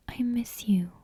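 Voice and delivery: ordinary delivery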